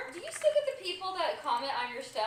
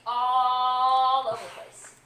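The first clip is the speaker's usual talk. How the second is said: loud, long stretched "all"; drawn-out ALL; 11 dB louder, much slower than usual. Pretend. Co-host teasing about accents, "all" loudly stretched. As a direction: hold "all" out for comic emphasis.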